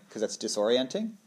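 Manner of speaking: ordinary talk, nothing marked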